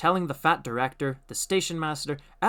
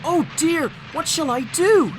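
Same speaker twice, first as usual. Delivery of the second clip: high, loud alarmed cry; panicked engine; 8 dB louder than usual. A narrator's reading. Thomas rushing toward the buffers, in alarm. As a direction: fret in alarm.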